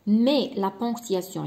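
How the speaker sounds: ordinary talk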